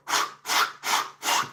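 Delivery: repeated breathy swish noises; corduroy swishing. Acted. Showing corduroy noise as you walk, rhythmic swishes. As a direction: imitate fabric rubbing in steady strides. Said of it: "You get that".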